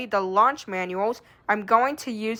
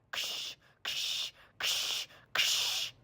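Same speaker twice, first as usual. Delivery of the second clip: whispered static; 14 dB quieter, much slower than usual. Acted. Radio static sound.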